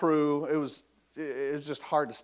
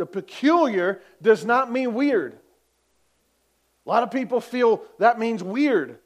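rising, louder preacher's call; fervent preaching; 7 semitones higher, 8 dB louder than usual. Real, not acted. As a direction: preach it out to the congregation. Preaching at full force.

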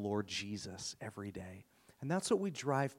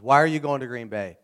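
much louder, incredulous question; imitated baffled neighbor; 12 dB louder than usual. Crank neighbor asking about Green Bay trip, loudly incredulous. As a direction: play the neighbor loudly demanding to know why.

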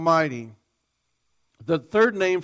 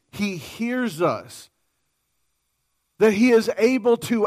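ringing, slightly raised preaching voice; preaching at full force; 3 semitones higher, 4 dB louder than usual. Acted. Preacher voicing God's claims to the congregation, fervently. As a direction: proclaim it with full conviction.

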